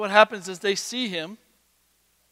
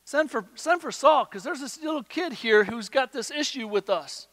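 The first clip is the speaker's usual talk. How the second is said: higher, scoffing soldier voice; dismissive soldier, pitched up; mocking the soldiers; 6 semitones higher, 4 dB louder than usual. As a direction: tattle on the kid to the king. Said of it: "pretty frustrated".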